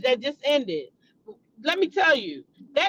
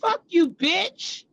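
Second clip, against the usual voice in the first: slow, deliberate "Fuck you, bitch."; retold curse; 3 semitones higher, much slower than usual. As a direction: spit each word out slowly, fed up. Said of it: "And then one day I said".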